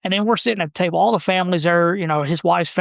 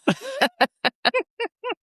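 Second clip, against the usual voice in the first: high, long giggling laugh; real laugh; 3 dB quieter than usual. Real, not acted. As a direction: crack up at your own story. Laughs after retold punchline.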